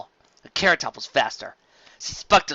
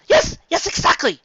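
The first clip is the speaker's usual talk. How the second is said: much higher, excited confirmation; eager yes; 8 dB louder than usual. Acted. Carrot Top confirms to the guide, high and eager. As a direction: jump at the news.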